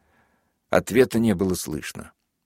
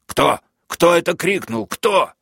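rising, ever higher furious yell; roaring; 10 semitones higher, 4 dB louder than usual. A narrator's reading. Major roars at the crowd to find the shouter, rising higher. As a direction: roar at the crowd, climbing. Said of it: "заревел майор".

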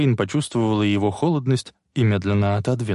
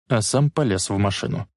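ordinary delivery

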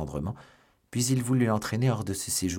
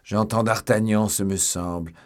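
loud hollow call, easing at the end; 6 dB louder than usual. A narrator's reading. Athos calls from the cellar, hollow-voiced. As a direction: call hollowly through a door. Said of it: "la voix creuse d'Athos".

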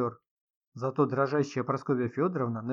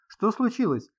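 higher-pitched urgent question; alarmed question; 9 semitones higher, 4 dB louder than usual. A narrator's reading. Sid rushes to the screaming woman, alarmed. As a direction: ask in alarm, pitch jumping up.

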